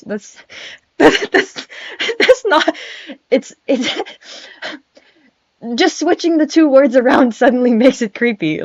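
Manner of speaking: high giggly talk broken by laughs; laughing. Real, not acted. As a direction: giggle through the words. Giggling at her own creepy line.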